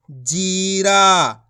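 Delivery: जीरा very slowly, both vowels held; drawn-out long vowels. Shown as a demonstration. Teacher models the joined word, vowels drawn out. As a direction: stretch जीरा out syllable by syllable. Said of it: "खींच के पढ़ेंगे".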